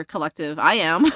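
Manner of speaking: usual speaking voice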